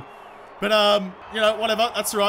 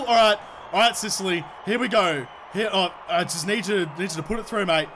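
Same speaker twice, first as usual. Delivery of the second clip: normal talking voice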